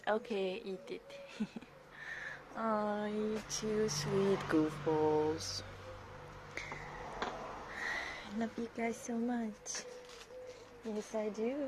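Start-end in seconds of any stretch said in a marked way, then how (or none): 2.6-11.6 slowly, with real tenderness; tender. Real, not acted. Tender words to dogs.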